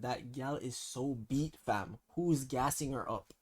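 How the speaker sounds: slowed right down, each word laid out; slow and clear. Shown as a demonstration. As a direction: repeat it slowly so it sinks in. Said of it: "No, I said".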